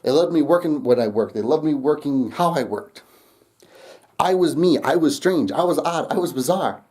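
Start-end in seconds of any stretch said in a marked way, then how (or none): none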